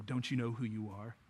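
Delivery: low, very soft, insinuating tempter; the enemy. Retold or imitated. Enemy getting on the believer's level, quiet accusing voice. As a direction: drop low and hushed, needling the listener. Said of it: "The enemy would love to get on your level and go".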